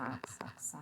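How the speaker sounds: whispered accent; practising accent. Shown as a demonstration. Learner whispering accent to practise. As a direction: whisper accent over to yourself. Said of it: "Il a un accent anglais".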